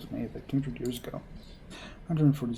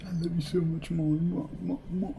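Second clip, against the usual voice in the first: higher, syrupy lovestruck coo piling on; gushing lovebird; 4 semitones higher than usual. Acted. Girlfriend outdoes her boyfriend's love, gushing. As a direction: keep escalating the sappy love talk. Lovey-dovey couple behind you.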